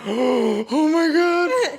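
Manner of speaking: high, very slow, stretched-out wail; re-enacted fright. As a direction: draw it out in mock terror. Acts out fear while steering.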